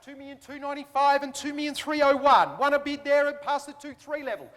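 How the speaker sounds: brisk auction patter; auctioneer calling. Real, not acted. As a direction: rattle off bids like an auctioneer.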